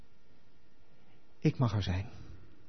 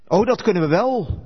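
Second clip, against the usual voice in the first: higher and louder, keen and sure of themselves; eager insistence; 8 semitones higher, 7 dB louder than usual. Acted. Disciples eagerly assure Jesus they can, a keen high call.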